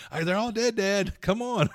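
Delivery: put-on high, loud kid's call; mimicking son. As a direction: play the impatient kid yelling at dad. Son's call mimicked by father.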